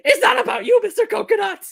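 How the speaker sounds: high, loud angry yell; angry shout. Retold or imitated. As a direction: snap it at the puppet. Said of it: "her angry voice over Mister Coconuts".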